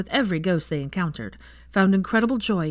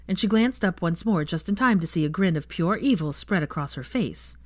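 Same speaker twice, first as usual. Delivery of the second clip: plain reading voice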